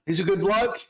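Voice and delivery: high-pitched Aussie impression; hammed-up Australian bloke; Australian accent. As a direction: put on a high Australian twang. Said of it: "maybe just do the Australian".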